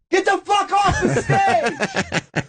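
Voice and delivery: very high, drawn-out yell; balcony heckler. Retold or imitated. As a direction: heckle from far back in a theater. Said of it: "I just hear from the balcony".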